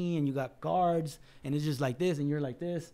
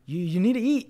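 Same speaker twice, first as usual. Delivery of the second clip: put-on talking to a kid; 5 semitones higher, 6 dB louder than usual. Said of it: "talking to a fucking kid".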